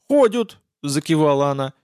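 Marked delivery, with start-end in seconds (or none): 0.1-0.6 high, eager granny reply; pitched-up old lady confirming; old woman. Acted. Old woman answers, nodding.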